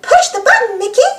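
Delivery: high sweet mouse voice; Minnie Mouse. Acted. Minnie urging Mickey, high and sweet. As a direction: pitch it way up, coaxing. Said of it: "Minnie says".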